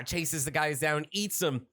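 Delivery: ordinary delivery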